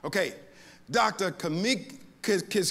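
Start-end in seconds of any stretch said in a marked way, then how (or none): none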